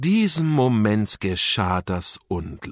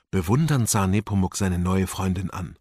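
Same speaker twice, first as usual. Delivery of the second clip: plain reading voice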